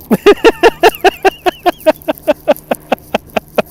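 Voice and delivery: a high, airy burst of laughter; high giggling laugh. Real, not acted. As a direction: laugh it off, delighted. Overwhelmed by super chat praise.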